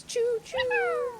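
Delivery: high, drawn-out choo choo; playful train whistle. Pretend. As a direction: toot like a toy train. Imitates train for hype train.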